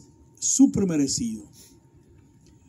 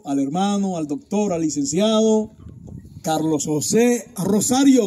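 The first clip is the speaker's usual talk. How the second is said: usual speaking voice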